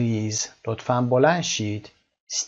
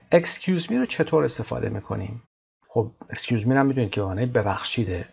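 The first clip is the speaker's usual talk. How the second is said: ordinary talk, nothing marked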